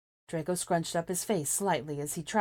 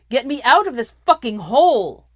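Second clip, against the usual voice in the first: much louder desperate roar; 8 semitones higher, 16 dB louder than usual. A narrator's reading. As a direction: bellow at the sky to be freed. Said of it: "Draco roared".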